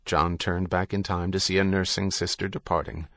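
plain reading voice

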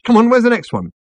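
high, loud, eager demand; urgent impatience. A narrator's reading. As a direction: press excitedly for the next one. Ford impatient for more letters.

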